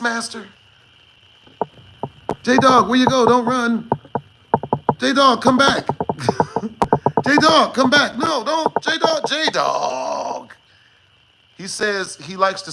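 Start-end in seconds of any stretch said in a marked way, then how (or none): 2.4-5.9 shouting after someone leaving; calling out. Real, not acted. Calling after departed chatter. 7.2-10.5 shouting after someone leaving; calling out. Real, not acted. Calling after departed chatter.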